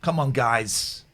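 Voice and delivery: slow, stretched come on; drawn-out plea. Shown as a demonstration. Streamer pleading with chat in a slow drawn-out voice. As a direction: drag out come on, guys slowly. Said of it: "repeated plea".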